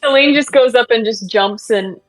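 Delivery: loud, high, giddy; excited. Real, not acted. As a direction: gush it out. Excited player over laughing.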